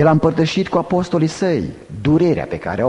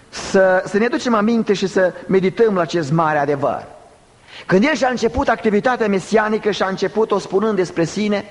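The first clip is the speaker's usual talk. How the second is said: high-pitched, insistent preacher; forceful preaching; 7 semitones higher than usual. Real, not acted. Preacher calling listeners to meditate on a truth, forceful preaching. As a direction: urge the congregation in a raised pitch.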